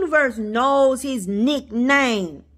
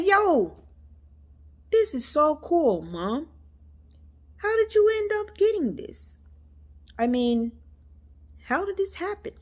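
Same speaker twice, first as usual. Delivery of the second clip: higher-pitched take on her son's voice; imitating her son; 7 semitones higher than usual. Mom imitating her son reacting to the photo, amazed. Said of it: "That's how he talked".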